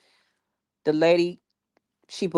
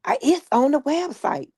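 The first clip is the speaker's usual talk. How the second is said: high, mocking sing-song of a rude stylist; mock-snippy, high and pointed; 10 semitones higher than usual. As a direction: throw the line back at her in a much higher voice.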